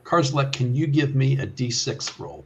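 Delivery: ordinary delivery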